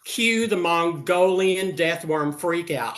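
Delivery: ordinary talk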